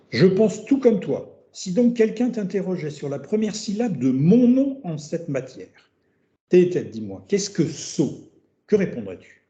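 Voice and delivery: measured reading voice for Socrates; reading a text aloud. Acted. Teacher reads Socrates' words to students, measured reading. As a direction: read the dialogue line out to the class.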